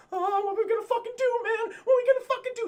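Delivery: very high, loud cowardly wail; mock panic. Mocking Antoine panicking on guard duty, high frantic wail. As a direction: squeal it like a hammed-up cartoon coward. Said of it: "Antoine's like".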